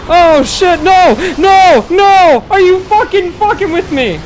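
very high, loud real scream; panicked screaming. Real, not acted. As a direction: scream in horrified disbelief. Snake attack, screaming in panic.

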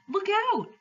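high-pitched cry of alarm; called warning. A narrator's reading. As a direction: call out a sudden warning. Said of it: "called several voices".

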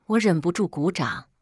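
ordinary delivery

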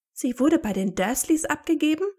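loud, high outraged question; beside herself. A narrator's reading. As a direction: exclaim in appalled disbelief. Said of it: "fragte Hermine außer sich".

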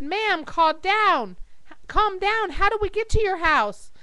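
high, mildly exasperated plea; lightly acted call handler; urgent dispatcher. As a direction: press the caller to calm down. Dispatcher urges caller to calm.

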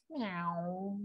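soft drawn-out mew; cat's meow. A narrator's reading. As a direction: mew quietly like a cat. Voicing the cat in story.

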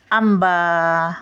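आंबा slowly, with a nasal आं. Shown as a demonstration. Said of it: "मग आवर टिंब". (आंबा मध्ये आं ओढून)

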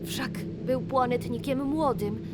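ordinary delivery

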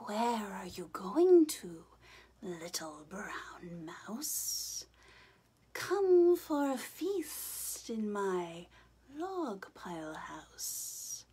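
slow, whispered snake voice; hissing snake. Acted. Snake luring the mouse, slow and whispered. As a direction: hiss it slowly like a sneaky snake.

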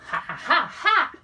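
bursts into a delighted cackle, last ha jumping high; real gleeful laugh. Real, not acted. [name] laughing at her own neat trick, a gleeful cackle. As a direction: laughs with glee at the cancellation. Pleased laugh at cancelling terms.

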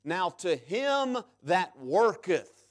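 slow, deliberate reading of the verse; slow scripture reading for emphasis; read slowly. Shown as a demonstration. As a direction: draw each word out. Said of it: "Let's read it quick, uh, slowly".

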